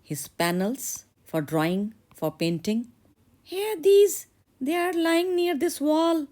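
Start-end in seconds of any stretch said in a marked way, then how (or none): 3.4-6.3 put-on high girlish voice, sweet then worried; young girl in love. Daughter tells the blacksmith her father won't approve, in a high girlish voice. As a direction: play the bright young daughter fretting about her father.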